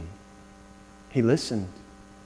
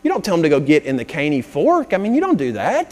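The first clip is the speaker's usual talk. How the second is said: usual speaking voice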